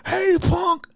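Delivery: spring it on him like a bully; mock bully ambush. Mo jumping out at Mel, hammed-up bully taunt. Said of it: "Mo jumps out like".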